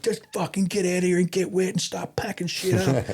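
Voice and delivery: high-pitched mock scolding; impatient ordering. Ex-infantry mate scolding the handlers in rain, impatient. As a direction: rattle off exasperated orders, pitched well up.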